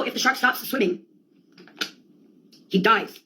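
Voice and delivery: ordinary delivery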